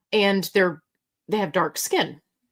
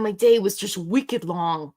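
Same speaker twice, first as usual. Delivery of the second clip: put-on Boston accent. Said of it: "be like".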